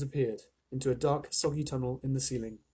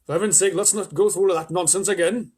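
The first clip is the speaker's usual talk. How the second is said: higher, louder exasperated worm; impatient earthworm; 5 semitones higher, 7 dB louder than usual. A narrator's reading. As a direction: huff it out, fed up. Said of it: "the earthworm said".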